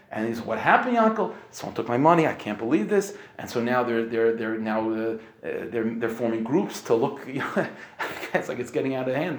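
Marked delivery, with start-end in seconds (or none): 0.4-3.2 alarmed neighbors. Retold or imitated. Neighbors rush in, victim distraught. 7.2-9.4 laughing along. Real, not acted. Laughing at the escalating mess.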